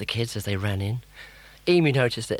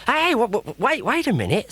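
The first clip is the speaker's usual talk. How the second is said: high, loud stammering; flustered protest; 5 dB louder than usual. Acted. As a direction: stop them in a panic. Rod protests.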